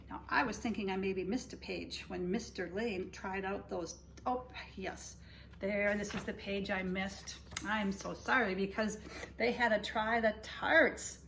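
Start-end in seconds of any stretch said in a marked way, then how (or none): none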